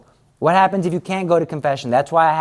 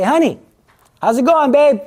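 sweet husband greeting; 9 semitones higher, 5 dB louder than usual. Acted.

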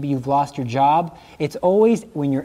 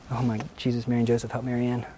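mock exasperated prayer; 6 semitones lower, 8 dB quieter, faster than usual. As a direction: lower and softer, mock-despairing aside. Mock exasperated plea to saints.

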